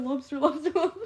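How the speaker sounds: high laughing chant of lobster; laughing read. Real, not acted. Reading Immortal's lobster message while laughing. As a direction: giggle through the word.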